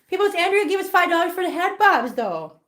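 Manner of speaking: high, pleading pet voice; wheedling the pet for a trick; coaxing a pet. Real, not acted.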